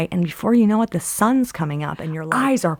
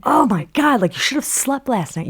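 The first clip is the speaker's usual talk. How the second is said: acted-out scolding, pitched up in disbelief; exasperated scolding; 7 semitones higher than usual. Partner scolds the sleepless teammate, exasperated.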